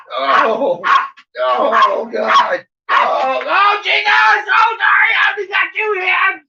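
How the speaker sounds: high squeal building to a shriek; hammed-up dog beside itself with excitement; overexcited dog. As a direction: climb into a frantic high-pitched dog wail. Playing the overexcited dog, rising squeal.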